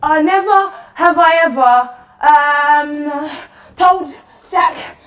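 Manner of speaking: loud, high tipsy yelling; drunken shout. Acted. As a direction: bellow it out, drunk and merry. Drunk game getting rowdy.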